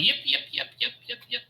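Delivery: high yapping hyena yips; gnoll yipping. Acted. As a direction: yip like a pack of frantic gnolls. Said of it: "the gnolls are just going".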